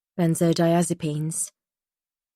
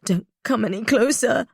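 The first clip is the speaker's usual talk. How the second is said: scared, higher pleading voice; frightened plea; 5 semitones higher than usual. Acted. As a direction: plead in fear.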